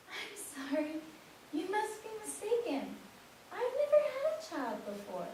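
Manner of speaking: confused mother. Acted.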